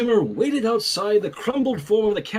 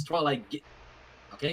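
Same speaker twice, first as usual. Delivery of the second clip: usual speaking voice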